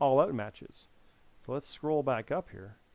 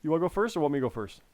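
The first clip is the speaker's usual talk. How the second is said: ordinary talk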